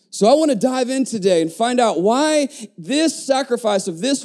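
high-pitched, emphatic proclamation; impassioned preaching. Real, not acted. Preacher telling the church why her sacrifice mattered, impassioned preaching. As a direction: preach it out in rising waves, dropping at phrase ends.

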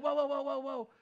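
high, rapid-fire protest; mock alarm. Jesus stopping Mary's big hug, comically alarmed. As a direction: hold off an eager hugger in playful alarm.

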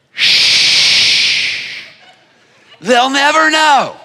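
conspiratorial hush then loud; sneaky fish keeping a secret; shushing fish. Acted. Fish whispering to each other, sneaky. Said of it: "fish under there going".

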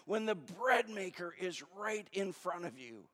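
exasperated, leaning on the key words; frustrated. Acted. Jesus to disciples fixated on bread, frustrated. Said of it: "he gets frustrated".

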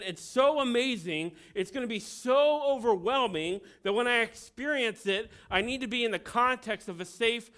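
hammed-up teenage confusion, pushing hard; mock agitated rant. Pastor replaying his teenage dilemma, agitated and loud. Acting teen's torn confusion.

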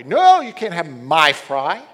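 put-on loud, possessive kid; a child loudly guards his fries; kid refusing.

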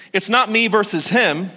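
normal talking voice

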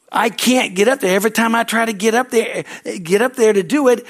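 high, complaining excuse; whiny excuse. Retold or imitated. Man at the pool complaining to Jesus, high and whiny. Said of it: "the guy's like".

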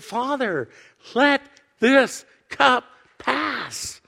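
slow, drawn-out cry, pitch high; anguished prayer. Retold or imitated. Jesus begging the Father in the garden, in anguished prayer. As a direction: imitate Jesus crying out, stretching each word. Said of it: "he didn't put a happy face on it".

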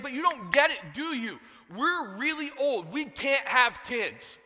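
high, loud, stretched-out protest; exasperated Zechariah. Acted. Preacher as old Zechariah arguing with the angel, exasperated. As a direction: spell it out in hammed-up exasperation. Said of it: "And he's like".

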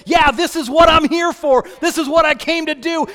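loud, certain declaration; resolute declaration. Acted. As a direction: declare your purpose with conviction. Jesus declares his purpose.

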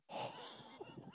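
short high airy laugh at his own story; breathy high laugh. Real, not acted. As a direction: laugh it off, tickled. Laughing at the memory.

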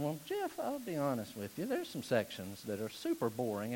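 hushed, sheepish mock confession; hesitant churchgoer confessing. Imagined listener confessing to the pastor, hushed and sheepish. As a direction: drop the volume and admit it reluctantly. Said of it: "you're sitting there and you're thinking".

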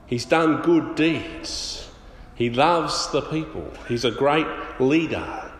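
very slow, each word spaced out; slow and deliberate. Shown as a demonstration. Preacher stresses the centurion's good deeds, very slow. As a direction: lay out each word slowly for weight.

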